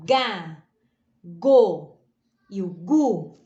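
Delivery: "ga", "go", "gu" separated, hard g; teacher's isolated syllables "ga, go, gu"; syllable drill. Shown as a demonstration. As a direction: punch out "ga, go, gu" with pauses. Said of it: "quando nós queremos o som".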